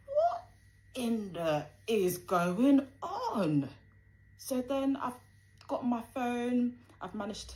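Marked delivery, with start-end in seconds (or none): 0.1-3.7 high, stretched, laughing "what is going on"; shocked disbelief. Retold or imitated. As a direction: squeal it out in stunned disbelief, half laughing. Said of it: "So I was like".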